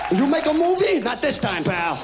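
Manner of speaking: high, loud mock tough-guy taunt; action hero taunt. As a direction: sneer it like a movie hero.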